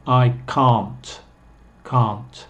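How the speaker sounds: "can't" with long ah, as kahnt; slow, broad kahnt for "can't"; British can't. Shown as a demonstration. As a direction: draw out the vowel of "can't" as ah. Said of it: "Yo digo".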